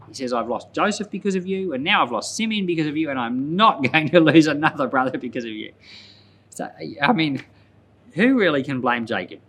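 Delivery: ordinary delivery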